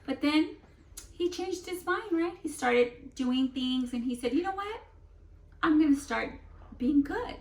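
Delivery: bright, encouraging lift; hopeful retelling. Acted. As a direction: make his change sound exciting. Retells the seed's resolve.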